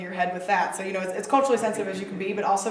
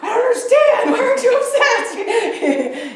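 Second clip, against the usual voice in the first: much higher and louder, laughing through the words in mock disbelief; baffled laughing; 11 dB louder, slower than usual. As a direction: squeal it out, laughing and puzzled, slowing on the second line. [name]'s baffled reaction, laughing.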